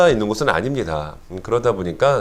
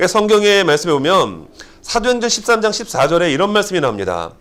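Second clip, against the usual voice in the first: usual speaking voice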